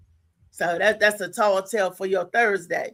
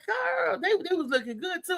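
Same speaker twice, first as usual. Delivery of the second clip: put-on sassy girl voice; 7 semitones higher, 5 dB quieter than usual.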